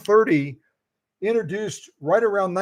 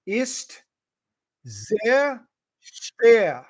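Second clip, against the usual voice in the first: careful German; 3 semitones higher, much slower than usual. Shown as a demonstration. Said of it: "So you say".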